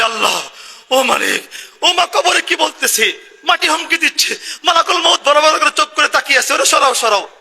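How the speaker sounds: high, panicked begging to be saved; frightened plea. Acted. Dying sinner begging God, high and panicked. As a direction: cry out as if the grave terrifies you. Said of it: "লোকটা বলে".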